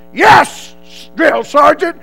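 loud, high reply with a pause; recruit sounding off. Retold or imitated. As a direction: answer up loudly but unwittingly. Recruit answers the wrong title.

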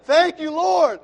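lifts the thanks into a loud, high cry; full-voiced shout of thanks; shouted praise. Real, not acted. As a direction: cry it out to the Lord. Thanking God, then shouting praise.

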